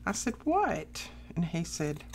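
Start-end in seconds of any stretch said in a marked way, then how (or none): 0.5-1.1 high-pitched retold worry; high, falling "what" of mock dread; alarmed question. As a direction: imitate asking in alarm. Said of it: "I thought something was wrong terribly".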